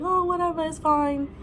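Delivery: high-pitched imitation of an unbothered mom; mock breezy brush-off; dismissive. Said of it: "that's why she took it like".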